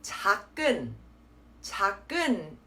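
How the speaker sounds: 작은 with the g doubled, as tak-kun; common mistake. Shown as a demonstration. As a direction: close 작 on a k and start 은 with another k. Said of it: "the G is there twice".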